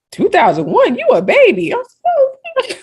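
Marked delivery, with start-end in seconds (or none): 0.1-1.7 surprised. Retold or imitated. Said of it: "They was like". 2.2-2.7 very high, giddy thank you; delighted thanks. Retold or imitated. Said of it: "You were so happy".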